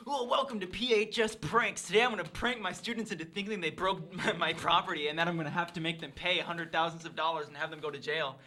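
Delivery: loud, bouncy mock YouTube intro; prank-channel host. Gym teacher welcoming viewers to P H S Pranks, hammed-up host. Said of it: "your gym teacher is a hardcore prankster".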